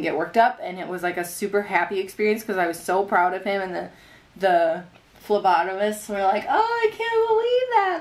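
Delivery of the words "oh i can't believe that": high-pitched retold astonishment; imitated amazement. Phlebotomists marvelling at calm baby, imitated amazement. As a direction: quote them in a high, marvelling voice.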